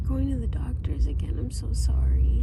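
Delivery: hushed whisper. Real, not acted. Whispering to baby.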